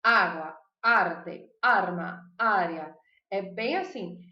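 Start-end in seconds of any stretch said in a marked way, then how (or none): 0.0-3.0 loud first A in "Água, arte, arma, área", then falling; stressed initial A. Shown as a demonstration. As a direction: punch the opening A of each word. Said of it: "começam com A tônico".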